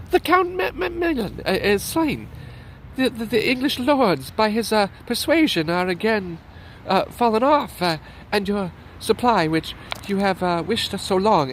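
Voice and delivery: a flustered messenger blurting out bad news; stammering messenger.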